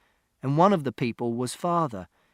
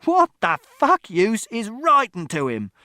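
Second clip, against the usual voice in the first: raised echo building to loud outrage; scornful shout; 9 semitones higher, 7 dB louder than usual. A narrator's reading. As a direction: throw it back, then explode slowly. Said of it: "Father shouted".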